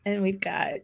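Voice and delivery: ordinary talk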